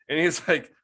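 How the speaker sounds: usual speaking voice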